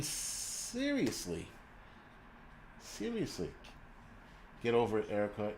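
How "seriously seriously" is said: soft, high, drawn-out word; quiet, stretched-out incredulity; hushed disbelief. Real, not acted. Mock disbelief at the critic.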